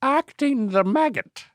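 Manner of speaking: high-pitched Irish echo; silly, high Irish lilt; mock Irish accent. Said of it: "You gotta use an Irish accent".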